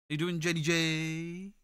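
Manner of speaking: slow, odd stretched greeting; silly drawn-out voice. Real, not acted. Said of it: "Don't know why I said it like that".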